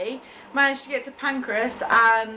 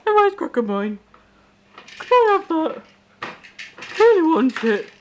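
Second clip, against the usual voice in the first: high, pleading pet-dog voice, louder; voicing the begging dog; 6 semitones higher, 4 dB louder than usual. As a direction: beg in a put-on doggy voice, drawing out the end. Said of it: "Bug's like".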